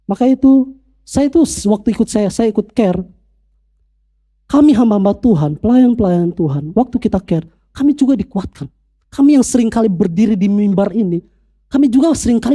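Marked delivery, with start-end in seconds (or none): none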